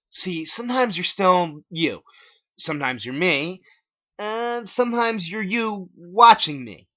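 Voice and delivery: dramatic villain reveal; ominous Clyde. Acted. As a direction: explain it slowly and meaningfully. Clyde explains twist.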